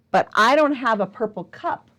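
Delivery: ordinary delivery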